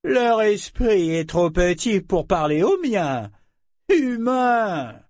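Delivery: high, slow, haughty dragon voice; disgusted dragon. A narrator's reading. Dragon speaking of lesser human minds, disgusted. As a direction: draw the words out with lofty contempt. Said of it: "se ternirent de dégoût".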